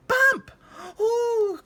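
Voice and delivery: high, loud startled yelp; frightened cry. A narrator's reading. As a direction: jump out of your skin. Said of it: "cried the goblin".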